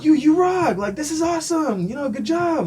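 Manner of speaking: high-pitched imitation of admiring children; excited kids. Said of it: "the kids would come to me".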